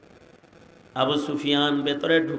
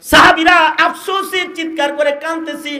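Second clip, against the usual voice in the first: high, loud intoned sermon delivery; impassioned preacher's high cry; full-force preaching; 11 semitones higher, 9 dB louder than usual. Real, not acted. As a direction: preach it out in a raised chanting voice.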